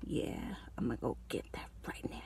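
low, hushed whisper of temptation; whispered thought. Retold or imitated. Whispering her own craving to viewers. Craving food after watching mukbang.

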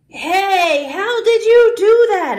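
high, loud astonishment; amazed question. A narrator's reading. Peter to friend after the flowers appear, amazed.

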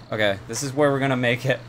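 usual speaking voice

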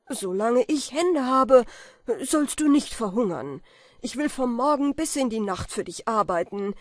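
hoch und laut, feierlich beschwörend; leidenschaftlicher Schwur. A narrator's reading. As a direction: versprich es mit ganzer Kraft. Kaspar beschwört den Freund. (Kaspar schwört Falke laut und inbrünstig)

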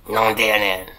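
low, loud and punchy; low loud exclamation. Shown as a demonstration. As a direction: bark it out in disbelief. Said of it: "The Japanese word of the day".

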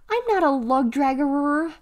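put-on high, indignant child's denial; protesting little brother. A narrator's reading. Brother denies being a lug draggerer, protesting high.

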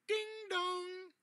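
high sung ding dong like a bell; doorbell chime. Acted. Reader chiming the doorbell for children.

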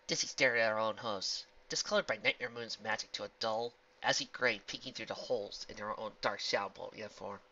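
usual speaking voice

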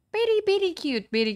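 gushing pet talk. Real, not acted. Player fussing over the game's cat, high baby talk. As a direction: coo over a cute pet, pitched high.

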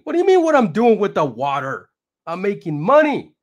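high, loud retort; mock rapper retort. Rapper answering friends about water, high and loud. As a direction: shoot back, annoyed and proud.

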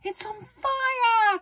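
very high, drawn-out alarm yell; yelling grandma. A narrator's reading. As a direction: raise the alarm, stretching FIRE. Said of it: "Grandma yelled".

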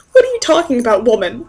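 ordinary talk, nothing marked

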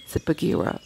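ordinary delivery, nothing marked

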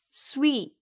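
스위 as "swee", not "swe"; teacher's short model of stressed 스위; corrected sound. Shown as a demonstration. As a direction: say 스위 with a clear ee. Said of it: "스웨가 아닌 스위 발음이 나요".

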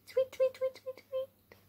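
very high, soft birdlike chirps; bird tweeting. Shown as a demonstration.

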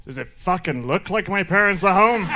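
put-on cheeky kid. Naked kid to door-to-door salesman, mock indignant. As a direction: throw it back as an exasperated question. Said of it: "the kid looks up and goes".

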